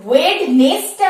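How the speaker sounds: ওয়েডনেসটা with the D sounded, wed-nes; spelt-out form. Shown as a demonstration. Teacher asking how to shorten it, spelt-out form. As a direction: read ওয়েডনেসটা as written. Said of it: "short ফর্মে বলবো".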